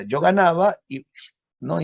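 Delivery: ordinary talk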